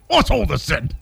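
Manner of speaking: lightly hammed British sketch voice; mock an English comic's catchphrase; Monty Python. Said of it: "that's Monty Python".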